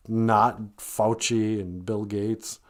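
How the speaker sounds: ordinary delivery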